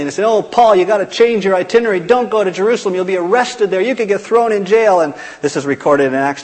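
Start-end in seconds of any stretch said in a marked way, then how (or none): 0.2-5.0 pleading wail. Retold or imitated. Friends tearfully begging Paul not to go, higher pleading. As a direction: beg him like frightened friends. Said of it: "everybody starts weeping and, and wailing".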